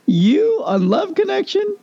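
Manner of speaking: high, slow mocking disbelief. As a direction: scoff at him like a joke. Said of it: "and they laughed".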